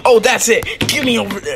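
high, loud burst; fed-up outburst. Acted. Wrestler jumping into the brawl, angry shout. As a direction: charge into the fight shouting. Charging into the acted fight.

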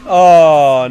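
high, loud shriek of laughter. Real, not acted. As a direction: burst into a squealing laugh. Surprised by LeBlanc hit.

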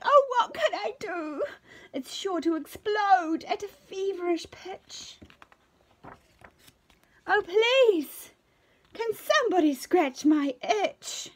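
high, loud wailing plea; desperate cry. Acted. Hare wails desperately for someone to scratch, loud high cry. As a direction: wail in itchy despair. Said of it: "till Fuzzy cried out".

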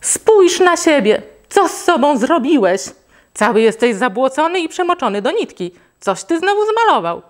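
put-on high, loud, furious scolding of a muddy child; a mother scolding her muddy son, angry and loud. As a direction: tell him off sharply, voice climbing high. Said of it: "i zaczęła się gniewać".

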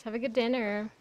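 ordinary talk, nothing marked

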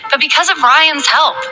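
plain reading voice

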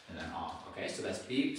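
normal talking voice

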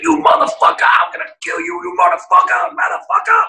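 hammed-up screaming caller on an answering machine; imitated raging voicemail. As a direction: play the furious caller screaming down the phone. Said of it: "It's like".

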